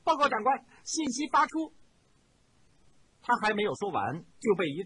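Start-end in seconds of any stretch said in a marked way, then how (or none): 0.0-1.7 joyful, high and loud report; excited report. A narrator's reading. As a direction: burst out with happy news. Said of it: "欣喜地站起来说道".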